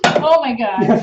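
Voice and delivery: slow, loud embarrassed groan of words; exasperated. Real, not acted. Wife squeezed onto the love seat on camera, loud slow exasperation. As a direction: drag it out, loud and mortified.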